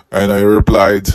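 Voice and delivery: ordinary talk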